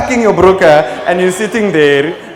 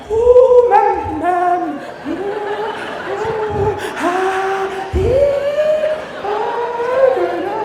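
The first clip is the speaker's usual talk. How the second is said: high, sing-song moaning wail; panicked wailing; much slower than usual. Pretend. Comic wailing in fear on the turning plane. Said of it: "being like".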